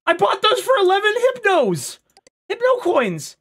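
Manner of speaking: very high, loud protest; outraged wail. Real, not acted. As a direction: cry out over wasted money. Protesting a lost purchase.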